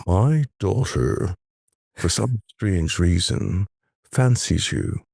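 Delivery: slow, measured warning with long pauses; unhurried, weighty imitation of a protective father; menacing voice in the ear. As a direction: deliver a deliberate threat, phrase by phrase. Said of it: "this voice came in my ear".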